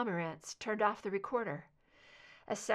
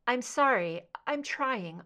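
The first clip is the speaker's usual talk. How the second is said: shaky, disoriented; 4 semitones higher, 6 dB louder than usual. Acted. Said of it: "shaky and disoriented sounding".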